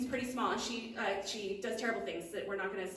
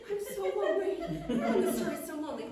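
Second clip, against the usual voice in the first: mock lonely whine; 8 semitones higher, 3 dB louder than usual. Gnome confesses loneliness.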